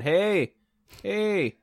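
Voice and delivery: high-pitched mock holler, not full volume; comic high "hey" of someone fleeing; mock scream. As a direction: call out a pretend scream.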